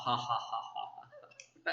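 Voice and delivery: a showy laugh to show what not to do; demo laugh. Shown as a demonstration. Teacher showing interview candidates what not to do, mock laugh. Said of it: "but you should not laugh".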